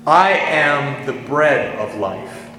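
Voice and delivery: slow, weighty stress on "I am", each title spaced out; solemn, drawn-out proclamation of "I am"; I AM in all caps. Retold or imitated. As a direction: lean hard on "I am" and take it very slowly. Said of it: "in all caps".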